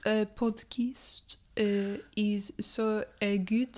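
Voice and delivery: put-on French-accented English with filler uhs; mock French accent. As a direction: play a French speaker searching for English. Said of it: "get my French accent".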